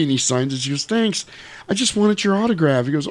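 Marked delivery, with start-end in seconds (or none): 0.8-2.8 high-pitched flattering voice; sweet lady customer. Retold or imitated. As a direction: thank him sweetly, pitched up.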